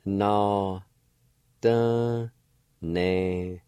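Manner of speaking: Na, da, ne sounded out one syllable at a time. Shown as a demonstration. Teacher sounds out a Cherokee word for learners, syllable by syllable. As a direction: spell out Na, da, ne slowly, syllable by syllable. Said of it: "It's pronounced like this".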